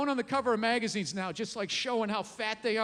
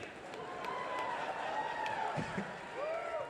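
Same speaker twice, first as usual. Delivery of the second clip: high laugh; 9 dB quieter than usual. Real, not acted. Comedian laughs at own joke.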